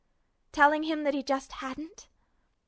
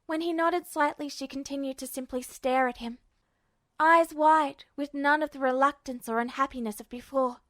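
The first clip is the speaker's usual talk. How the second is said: plain reading voice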